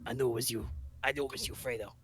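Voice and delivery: a quiet Sicilian don accusing his brother; hushed Sicilian gangster accent; Sicilian mobster. Acted. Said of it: "if you want me to be Sicilian".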